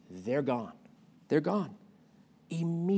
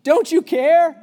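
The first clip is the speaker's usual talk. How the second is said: high, slow, pleading cry building louder; desperate plea; 10 semitones higher, 7 dB louder, much slower than usual. Retold or imitated. Disciples crying to sleeping Jesus in the storm, desperate rising cry. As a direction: beg for help as the boat sinks. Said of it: "they said to Jesus".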